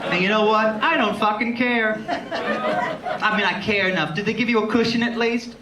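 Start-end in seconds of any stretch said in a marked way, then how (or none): none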